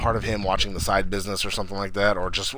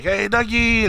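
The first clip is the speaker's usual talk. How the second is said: high, louder delighted call; happy greeting; 12 semitones higher, 5 dB louder, slower than usual. Retold or imitated. Brothers greeting a friend they are happy to see, delighted. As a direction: call out a cheery hello, pitched way up.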